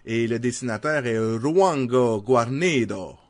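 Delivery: put-on names rolled out slowly in a Spanish accent; Québécois speaker trying a Spanish accent. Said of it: "Pardonnez-moi mon accent espagnol".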